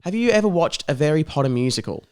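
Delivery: ordinary talk, nothing marked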